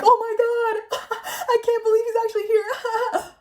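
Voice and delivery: high-pitched, giggly mocking imitation; squealed through mock laughter; imitated laughing. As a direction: mimic a friend cracking up through the words.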